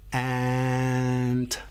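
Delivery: drawn-out slow word; stretched slowly. Shown as a demonstration. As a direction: say it slow like a turtle. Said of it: "say ant real slow like a turtle".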